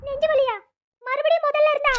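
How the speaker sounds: sudden high, loud alarmed cry; shocked. Acted. Woman hearing of uncle's heart attack, shocked and alarmed. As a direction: blurt it out in shock, voice jumping up. Shocked by news of chest pain.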